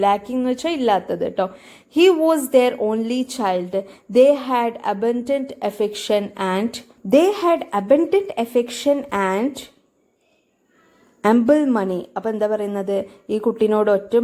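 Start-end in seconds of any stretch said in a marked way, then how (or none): 2.0-12.0 slow, careful reading of the passage; slow reading aloud. Shown as a demonstration. Teacher reading the story slowly to students. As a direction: read it out slowly for the class.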